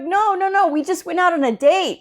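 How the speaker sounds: high-pitched, insistent explanation; protesting denial. Retold or imitated. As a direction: hastily correct the rumor, hammed up. Acted denial continues.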